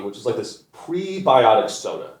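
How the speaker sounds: ordinary talk, nothing marked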